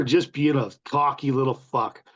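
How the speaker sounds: ordinary talk, nothing marked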